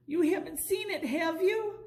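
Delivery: high anxious plea; pleading penguin. A narrator's reading. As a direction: ask hopefully, voice up high. Charlie pleads with lady.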